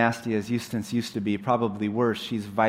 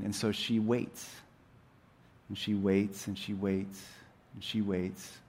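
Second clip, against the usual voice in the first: sinking lower and softer with each wait; hushed suspense; 3 semitones lower, 7 dB quieter than usual. A narrator's reading. Telling how Jill waits by the lion, hushed and patient. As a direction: draw out the waiting, fading away. Repeats waiting, growing quieter.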